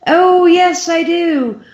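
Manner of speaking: very high, loud, drawn-out confirmation; emphatic recognition. Retold or imitated. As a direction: sing out the yes, high and emphatic. Retold delighted answer on the phone.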